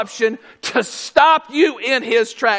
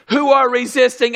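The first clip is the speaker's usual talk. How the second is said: ordinary delivery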